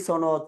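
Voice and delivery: "সনদ" said with plain s, sonod; the casual sonod form of "সনদ"; common mispronunciation. Shown as a demonstration. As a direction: open "সনদ" on s instead of sh. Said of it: "এইভাবে বলি".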